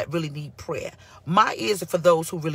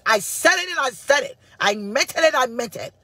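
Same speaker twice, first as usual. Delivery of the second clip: higher, louder sassy declaration; Nene Leakes; 6 semitones higher, 3 dB louder than usual. Retold or imitated. Pastor quoting Nene Leakes to critics, high sassy declaration. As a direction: throw the line out with diva attitude. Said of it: "in the great words of Nene Leakes".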